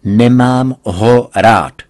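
loud, slow, every word spelled out; father proclaiming to his daughter; declared loudly. A narrator's reading. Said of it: "zvolal jsem".

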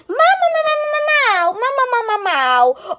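high babbly cartoon meow; mock Gary mewing back; snail meow. Child voicing the snail Gary.

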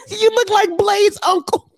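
high laughing jab; mock him through the laugh; laughing roast. Real, not acted. Roasting photo.

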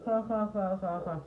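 mock the woman's laughter; imitated cackle. Imitating the woman laughing at her on the bus. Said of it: "se iba riendo".

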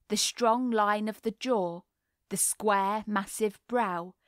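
plain reading voice

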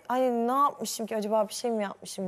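slow, wondering, hurt tone; puzzled and a little wounded, slowly. Retold or imitated.

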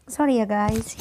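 ordinary talk

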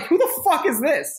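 baffled crowd. Retold or imitated. Partygoers at the party wondering who he is, high and incredulous. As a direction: squeak out the crowd's disbelief. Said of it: "'cause they're like".

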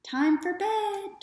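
mother calling out. Acted. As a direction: call the boys in from across the garden. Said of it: "Tom's mother called".

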